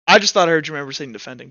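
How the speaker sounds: ordinary talk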